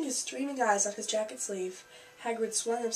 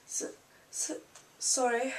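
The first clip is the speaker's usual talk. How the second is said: stammered, lightly acted sob; sobbed apology; 3 semitones higher than usual. A narrator's reading. Giant apologising for his howl, mildly sobbing stammer. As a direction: stumble tearfully on the first sound. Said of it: "sobbed Hagrid".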